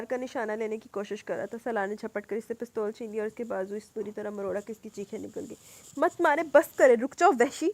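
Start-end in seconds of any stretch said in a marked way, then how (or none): 6.0-7.7 high, loud tearful yell; crying scream. Acted. Ruha screams at Salar to stop, crying. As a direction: shriek at him through tears. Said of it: "روتے ہوئے چلائی".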